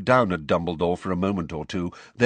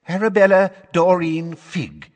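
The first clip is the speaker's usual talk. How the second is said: high, loud, quavering witness; quavery voice; 10 semitones higher, 8 dB louder than usual. Acted. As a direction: quaver through the name in a high voice. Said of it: "in her quavery voice".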